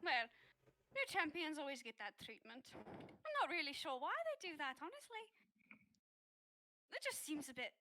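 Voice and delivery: put-on priestess with a light Irish accent. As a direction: slip into a light Irish lilt. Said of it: "I don't know why I'm Irish all of a sudden".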